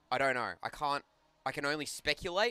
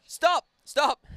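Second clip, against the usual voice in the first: high and loud, panicking; high panicked yelling; 7 dB louder than usual. Real, not acted. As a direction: yelp in alarm. Tangled cords panic.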